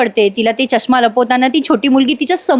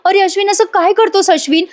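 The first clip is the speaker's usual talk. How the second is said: much higher, calling after him; retold loud cry; 8 semitones higher than usual. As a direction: cry out to someone walking away, played for the retelling. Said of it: "Priya मोठ्याने ओरडत असते".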